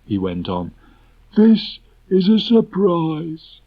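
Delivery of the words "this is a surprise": very high, loud, slow donkey voice; a grumpy donkey refusing to be hurried; Eeyore, slow and aggrieved. Acted. Eeyore tells Rabbit not to hurry him, slow and grumpy.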